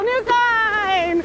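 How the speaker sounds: high excited sign-off mid-slide; sledding downhill. Real, not acted. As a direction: sign off while racing down a hill. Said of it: "sledding down a hill".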